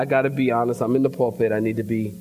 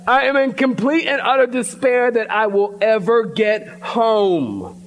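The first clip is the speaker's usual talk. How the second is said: exasperated outburst; 6 semitones higher than usual. Retold or imitated. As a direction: throw your frustration out like you did on the plane. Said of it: "I was like".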